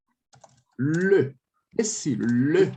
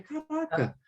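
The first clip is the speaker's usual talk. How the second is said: inner aha; 3 semitones higher, slower than usual. Retold or imitated. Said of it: "ele vai saber".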